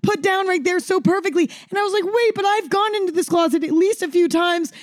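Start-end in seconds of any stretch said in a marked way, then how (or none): 0.0-1.5 hysterical mom. Retold or imitated. Mom answers in hysterical scene. 2.1-4.7 much higher, pleading disbelief; high frantic protest to mom; hysterical disbelief. Retold or imitated. Hysterical scene in closet.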